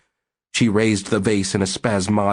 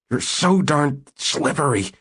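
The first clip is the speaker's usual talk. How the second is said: squeezed-out words, higher and slow; strained, struggling; 7 semitones higher than usual. Acted. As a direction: force it out while pinned. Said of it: "he managed to say".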